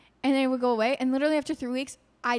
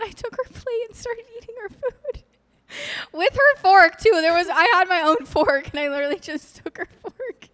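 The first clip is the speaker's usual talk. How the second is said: giggling while telling; cracking up mid-story; laughing through words; 8 semitones higher, 7 dB louder than usual. Real, not acted.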